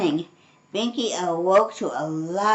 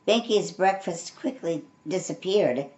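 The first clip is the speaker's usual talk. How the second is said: ordinary delivery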